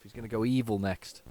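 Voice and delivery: ordinary talk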